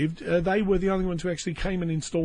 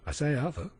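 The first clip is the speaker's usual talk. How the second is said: softer, tentative call for attention; restrained call; 5 dB quieter than usual. Pretend. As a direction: try to get Arthur's attention without raising your voice. First try at getting Arthur's attention.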